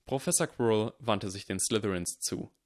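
usual speaking voice

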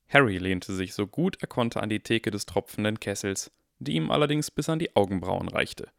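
ordinary delivery, nothing marked

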